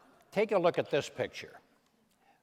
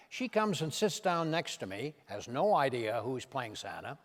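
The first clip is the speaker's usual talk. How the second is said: ordinary talk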